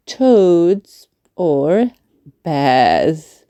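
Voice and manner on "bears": ordinary delivery, nothing marked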